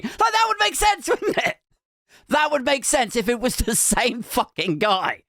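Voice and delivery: high-pitched comic outburst; mock-exasperated rant. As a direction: rant it high, as if it's obvious. Comic rant at absurd theory.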